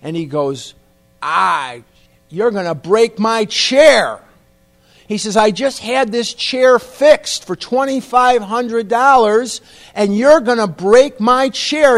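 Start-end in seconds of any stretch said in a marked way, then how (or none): 1.2-4.2 raised, slow, indignant mock holler; hollering dentist. Dentist fearing for his chair, indignant holler. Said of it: "He's hollering at me". 5.4-12.0 loud, high, slow irate rant; hollering dentist. Retold or imitated. Dentist to heavy patient about his chair, hollering. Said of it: "He's hollering at me".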